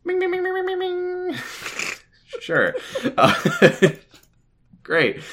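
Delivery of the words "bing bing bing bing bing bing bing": high bing bings. Pretend. Silly sound effect chant.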